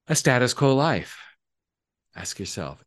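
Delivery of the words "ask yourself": usual speaking voice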